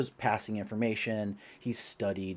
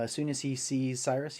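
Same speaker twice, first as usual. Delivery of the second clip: usual speaking voice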